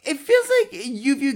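teasing through laughter; laughing. Real, not acted.

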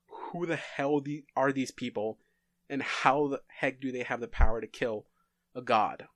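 ordinary talk

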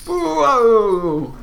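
surprised. Real, not acted. Reacting to waveform spike.

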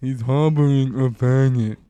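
slow, drawn-out gangster line; slow mobster voice. Pretend. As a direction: drag it out like a capo.

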